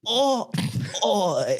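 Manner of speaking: high Aussie Oi cry; hammed-up Australian Oi; mock Australian climax. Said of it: "[name]'s impression of an Australian having sex".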